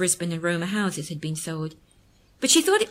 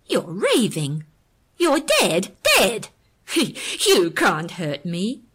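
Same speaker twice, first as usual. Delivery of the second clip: louder, frantic shout at the machine; hysterical; 5 dB louder, much slower than usual. Acted. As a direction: yell at the dead man's voice in panic. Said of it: "she responded hysterically".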